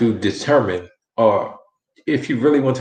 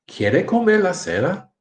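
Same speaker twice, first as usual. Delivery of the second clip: higher-pitched question, "cena" swinging up; question intonation; 9 semitones higher than usual. Shown as a demonstration. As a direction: ask "¿Quiere comer la cena?" high, ending in a rise on "cena". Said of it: "Can you hear the different inflection".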